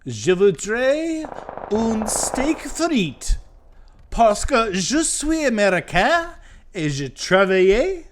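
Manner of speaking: high, slow, loud mock French; français exagéré, aigu et lent; hammed-up French. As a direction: ham up a French café order. Hammy French ordering.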